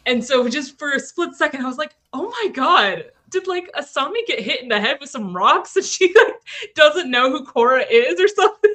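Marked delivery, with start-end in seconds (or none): 2.1-8.7 alarmed. Retold or imitated. Retold panicked thought.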